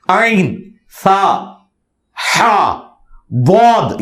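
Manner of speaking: slow, careful Arabic throat letters عین سا ہا بود; Arabic letters demo. Shown as a demonstration. Showing the host how Arabic letters should sound, slow and precise. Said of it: "یہ صحیح نکلنا چاہیے".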